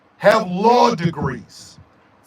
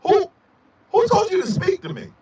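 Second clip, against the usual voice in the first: indignant sass; 8 semitones higher, faster than usual. Acted. Indignant mock retort.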